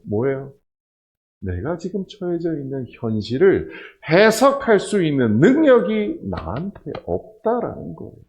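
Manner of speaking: ordinary talk, nothing marked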